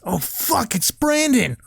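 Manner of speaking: high, startled cry of alarm; panicked alarm. Acted. Boy spotting the bully Brandon, high panicked alarm. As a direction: yelp it out as danger appears. Jimmy spots Brandon approaching.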